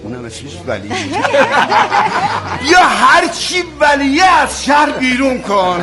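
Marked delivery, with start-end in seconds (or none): 0.9-2.6 a high real laugh. Real, not acted. Storyteller laughing that the sergeant is Vali too. 2.6-5.8 slow, much higher, louder shout; furious order. Acted. Officer shouting at the sergeant, furious.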